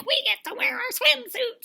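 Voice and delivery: put-on high-pitched girly excitement; mock giddy squeal. Women thrilled about the pool party, mock giddy.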